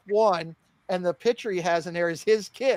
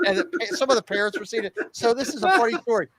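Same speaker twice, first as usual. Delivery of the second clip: ordinary talk, nothing marked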